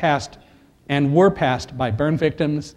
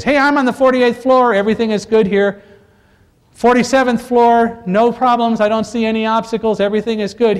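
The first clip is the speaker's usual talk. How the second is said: calling out down the stairwell, louder and higher; retold shouting; 6 semitones higher, 4 dB louder than usual. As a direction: shout the all-clear up the stairs. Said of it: "suddenly started shouting out".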